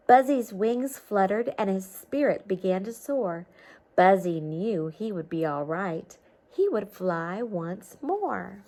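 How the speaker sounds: lively storybook read-aloud; reading to children. A narrator's reading. Teacher reading a storybook to children, lively read-aloud. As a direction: read it like a picture book to kids.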